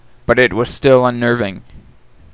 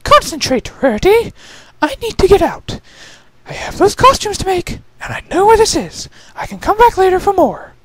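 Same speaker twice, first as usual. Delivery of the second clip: much higher, firm pep talk to herself; self-scolding lady. Acted. Rarity urging herself to leave the gems, higher and firm. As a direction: pull yourself together.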